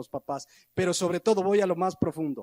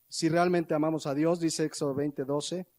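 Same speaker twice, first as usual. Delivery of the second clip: ordinary talk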